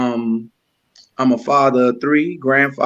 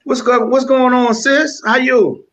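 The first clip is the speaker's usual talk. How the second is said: hyped greeting; 11 semitones higher, 4 dB louder than usual. Real, not acted. Guest greets host loudly, high.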